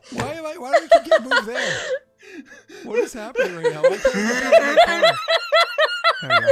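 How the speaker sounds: high loud laughing through words; can't stop laughing, squealing. Real, not acted. Helpless laughing fit.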